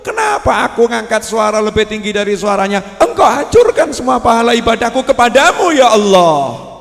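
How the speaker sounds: mock the boastful worshipper's protest; complaining worshipper. Boastful worshipper protesting to God, whiny and rising to a shout. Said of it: "Mungkin pola pikirnya begitu ya?".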